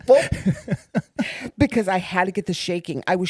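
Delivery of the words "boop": playful boop. Retold or imitated. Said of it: "And I said".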